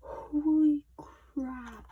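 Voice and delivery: very slow, loud, high amazed cry; amazed exclamation. Real, not acted. Fan seeing the standee, drawn out and loud in amazement. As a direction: stretch it out, floored.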